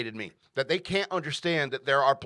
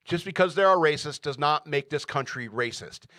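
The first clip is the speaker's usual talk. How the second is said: normal talking voice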